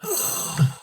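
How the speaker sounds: sharp theatrical high gasp; high dramatic gasp. Acted. Dying man's gasp as he begs the hero. Acted dying man scene.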